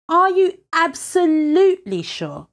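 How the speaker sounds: absolutely stretched out high; high, emphatic question built round absolutely; stress on absolutely. Acted. Boy slyly double-checks the deal.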